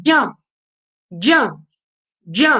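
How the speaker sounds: ज्ञा said as a blend of ज and य, gya-like; pronunciation demo. Shown as a demonstration. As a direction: demonstrate ज्ञा as ज joined with य. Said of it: "मैं ज्ञा नहीं बोल रहा हूं, ज और य का संगम बोल रहा हूं".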